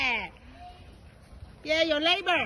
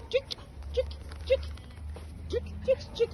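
high quick chick-chick calls; calling chickens; 6 semitones higher, 7 dB quieter, much faster than usual. Real, not acted. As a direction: call the chickens to come.

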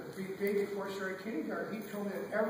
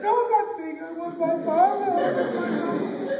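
very high, loud wail; crying child; 9 semitones higher, 11 dB louder than usual. Acted. Child refusing to be big, wailing for mama. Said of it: "She ended up crying".

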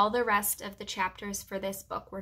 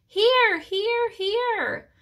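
very high squeaky calls; loud hamster squeak; 13 semitones higher, 5 dB louder, much slower than usual. A narrator's reading. Lost hamster answers his searchers, loud squeaking. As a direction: squeak it out as big as a tiny animal can. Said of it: "I squeaked as loudly as a hamster can".

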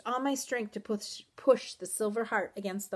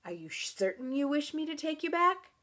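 high, sly creature question; mock-questioning horse voice; taunting horse; 7 semitones higher than usual. A narrator's reading. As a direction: toy with the rider.

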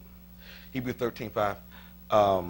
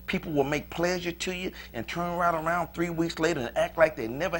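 rising, louder preacher's fervor; preaching build; 4 semitones lower, 6 dB louder than usual. Acted. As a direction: build it up toward the congregation. Preaches God's promise rising.

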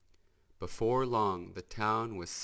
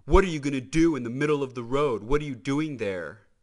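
put-on fast, louder, challenging young racer; brash hot rod; 5 semitones higher, 8 dB louder, faster than usual. Boost confronts Mater blocking the road, fast and brash. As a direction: fire questions at the deputy.

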